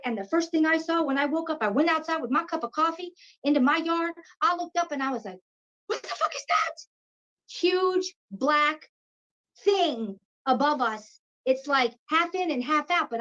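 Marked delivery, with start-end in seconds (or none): 5.9-6.8 high, breathy gasp of a question; hushed, high-pitched mock shock; retold stunned disbelief. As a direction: re-enact being stunned, pitch shooting up, voice half gone. Retelling her shocked reaction.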